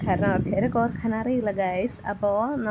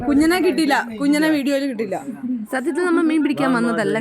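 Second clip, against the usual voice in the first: excited; 4 semitones higher, 6 dB louder than usual. Real, not acted. Excited vlog addressing viewers.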